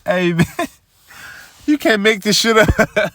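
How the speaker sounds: can't believe it, let it burst out; amazed outburst. Real, not acted. Laughing at the absurd betrayal.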